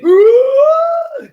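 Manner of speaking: huge high gasp of a newborn; big gasp. Acted. Newborn gasping for its first breath, loud gasp.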